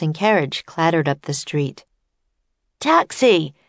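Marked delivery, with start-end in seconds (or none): 2.8-3.6 loud call for a cab; yelling. A narrator's reading. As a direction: shout it down the street. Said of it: "yelled Jack".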